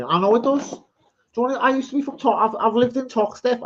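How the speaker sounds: high-pitched mock Scouse; put-on Liverpool accent. Answering a remark on his accent, put-on Liverpool accent. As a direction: pretend you're a Scouser, pitched right up. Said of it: "Accent sounds more Liverpool".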